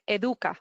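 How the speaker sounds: Educa said the Spanish way, eh-DOO-kah; Spanish pronunciation. Shown as a demonstration. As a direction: say Educa with pure Spanish vowels. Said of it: "How could you pronounce it?".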